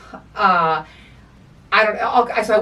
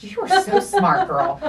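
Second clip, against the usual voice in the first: much higher, mock-sweet voice with a laugh; sarcastic praise; 12 semitones higher, slower than usual. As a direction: tease her with fake admiration.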